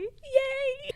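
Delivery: very high gleeful cheer; shrill-free high cheer of joy; high squeal yay. Real, not acted. Cheering queen's arrival.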